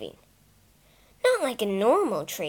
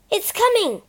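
louder, much higher call; 10 semitones higher, 6 dB louder than usual. Acted. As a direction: call a warning. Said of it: "called Peter".

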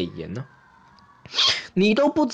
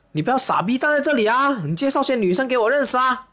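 higher-pitched mock scolding; retold annoyance; 6 semitones higher than usual. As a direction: act out nagging a useless friend. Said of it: "我就很生气". (模仿生气地埋怨朋友)

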